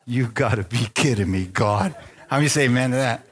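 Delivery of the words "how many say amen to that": ordinary delivery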